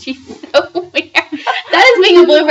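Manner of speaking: slow, giggly talk; words shaking with laughter; laughing. Real, not acted. Laughing at friend.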